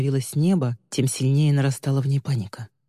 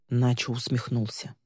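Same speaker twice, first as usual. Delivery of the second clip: ordinary delivery, nothing marked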